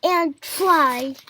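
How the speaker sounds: loud, drawn-out, building complaint; exasperated shout. A narrator's reading. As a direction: stretch each "tried" in frustration. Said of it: "shouted Elephant".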